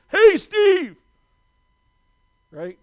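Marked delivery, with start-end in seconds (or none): 0.1-1.0 high, loud mock scream. Preacher voicing an all-caps message, screaming. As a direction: yell it like an all-caps email. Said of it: "it's like they're screaming at me".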